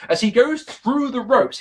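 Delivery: ordinary talk, nothing marked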